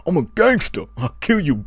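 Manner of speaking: high, hammed-up tough-guy impression; squeaky mock gangster threat. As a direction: play a wimp badly faking a gangster threat. Mocking [name] as fake gangster.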